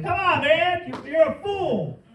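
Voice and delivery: hammed-up rude put-down; a loud, high rebuke of someone you just met; mock blunt scolding. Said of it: "don't be like".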